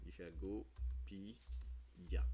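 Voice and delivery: Gopiya drawn out slowly, syllable by syllable; slow spelled-out demo. Shown as a demonstration. Slowly showing viewers how Gopiya is said. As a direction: stretch Gopiya out for the class. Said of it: "On comprend?".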